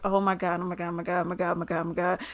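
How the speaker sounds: retold hushed panicky repetition; freaking out. In a date's car in a dark alley, quietly freaking out. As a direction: rattle it off softly under your breath, panicking. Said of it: "I'm over here freaking out".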